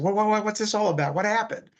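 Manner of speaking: high, loud stammering questions; freaking out. Retold or imitated. Pilot and nurse freaking out at the EMT's news, high and loud. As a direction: sputter the questions out in excited disbelief. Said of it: "they were kind of freaking out".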